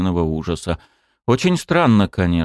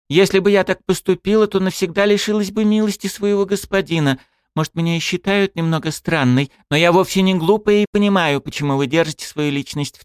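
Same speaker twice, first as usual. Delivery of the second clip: put-on high, earnest young woman; devoted girl; 11 semitones higher than usual. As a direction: speak with fervent devotion. Girl character voice.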